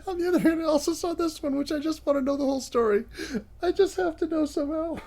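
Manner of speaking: high-pitched, giggly voice; laughing while talking. Real, not acted. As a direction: talk through helpless giggles. Cracking up over a shared meme.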